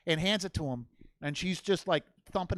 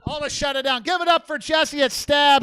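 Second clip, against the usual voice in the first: loud announcer sign-off; show host; 3 semitones higher, 7 dB louder than usual. Real, not acted. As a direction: wrap up the night for the crowd.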